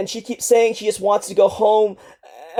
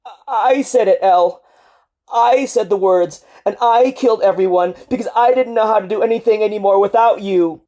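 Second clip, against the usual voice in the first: louder, higher, rushing through tears; sobbing; 3 semitones higher, 6 dB louder, faster than usual. Acted. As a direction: spill the guilt in one breath. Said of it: "shaking with sobs again".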